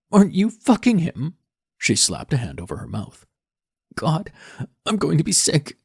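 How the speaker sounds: put-on high, loud, sickened teen girl; disgusted daughter. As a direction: recoil in revulsion.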